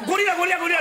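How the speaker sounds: high, loud repeated guessing; rapid-fire guesses. Retold or imitated. Wife keeps guessing gorilla at husband's angry gestures. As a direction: fire the word off over and over. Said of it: "って連発されて".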